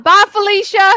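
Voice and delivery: dismissive send-off. Real, not acted. Cohost sends the critics off with a sassy, loud goodbye. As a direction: wave them off with a sassy goodbye.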